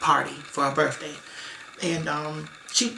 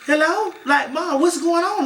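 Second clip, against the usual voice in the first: panicked; 10 semitones higher, 5 dB louder than usual. Retold or imitated. Panicked call to mother on phone.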